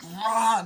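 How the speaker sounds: loud, high "rah" like a car tearing past; sudden loud imitated roar. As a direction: roar it out as a vehicle noise.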